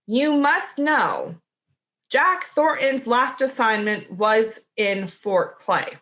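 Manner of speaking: "You must know" slow, falling flat at the end; downward intonation. Shown as a demonstration. To co-host, reading Bill's line as a statement, slow and falling. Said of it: "I read it as downward intonation".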